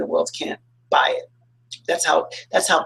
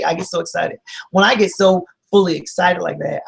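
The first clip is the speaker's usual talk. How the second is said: normal talking voice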